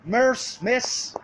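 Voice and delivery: high, loud urgent call; retold scream. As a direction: cry out after someone leaving, pitch leaping up. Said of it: "and I just scream".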